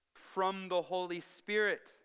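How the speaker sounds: high, slow stress on "from the Holy Spirit"; emphatic stress. Shown as a demonstration. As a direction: hammer home "from the Holy Spirit". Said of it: "Again, emphasis on how this came about".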